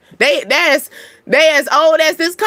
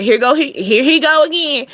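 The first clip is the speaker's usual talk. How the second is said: ordinary talk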